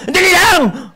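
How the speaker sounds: high, loud impatient yell; shouting back. Retold or imitated. Child yelling back at mother calling him to eat, loud high impatient shout. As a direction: snap back at mom from the other room. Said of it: "Makasigaw kang ganon".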